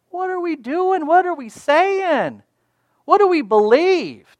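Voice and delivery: high-pitched rhetorical outcry; impassioned challenge. Real, not acted. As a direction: confront the congregation with urgent questions. Preacher challenging the congregation's doubt.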